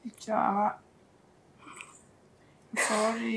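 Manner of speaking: softly acted apology; hushed apology. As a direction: imitate a timid, very soft sorry. Acts out friend's hushed apology.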